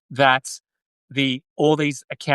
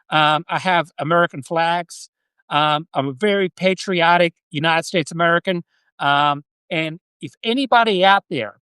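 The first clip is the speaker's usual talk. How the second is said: American accent. Shown as a demonstration. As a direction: pretend to be American, Australian doing an American accent. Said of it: "I will do a quick, an American accent".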